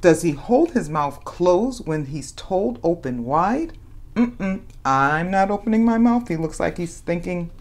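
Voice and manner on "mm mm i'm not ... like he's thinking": usual speaking voice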